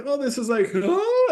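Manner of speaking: rising, squeaky panic as the boat tips; mock ride panic. Dad reliving the Splash Mountain drop, high mock panic.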